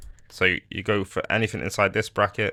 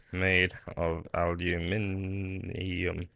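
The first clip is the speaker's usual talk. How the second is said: very slow and quiet, stretched syllables; sounding it out; 9 dB quieter, much slower than usual. Real, not acted. Tutorial maker typing an item description, sounding it out slowly. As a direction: murmur it slowly, word by word, as you type.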